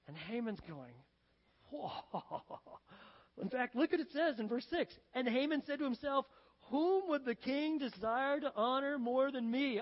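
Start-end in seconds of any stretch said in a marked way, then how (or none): 1.7-2.8 soft, long airy exhale of wonder; awed whoa. Acted. Haman awed by king's question. 6.7-9.8 slow, raised, mock-grand musing; self-important. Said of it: "so wrapped up in yourself".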